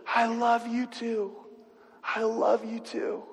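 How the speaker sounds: soft, slow and steady reply; sturdy confidence. Retold or imitated. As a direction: answer her quietly, firm and sure. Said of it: "with this sturdy confidence".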